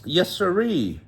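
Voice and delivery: high excited Tigger yell; bouncy Tigger voice. A narrator's reading.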